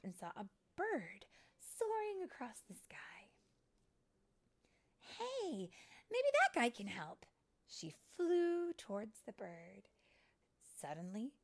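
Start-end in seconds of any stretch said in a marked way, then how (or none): 5.0-7.3 high, loud bright idea; hopeful discovery. Acted. Fairy spotting a bird who might help, excited. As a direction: perk up at a sudden chance of help.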